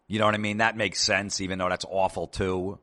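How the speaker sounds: ordinary delivery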